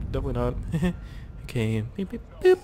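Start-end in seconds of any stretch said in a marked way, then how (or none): 2.0-2.6 high-pitched vocal beeps; mock electronic beeps. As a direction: imitate a scanner beeping. Voices the scanner's beeps.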